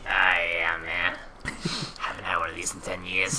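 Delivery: airy satisfied whisper; smoker's sigh. Pretend. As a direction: exhale it like a long drag.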